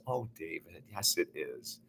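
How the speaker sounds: a mother softly reassuring her son; dead mother softly answering her son, tender; gentle, hushed reply. Retold or imitated. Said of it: "She was incredibly gentle".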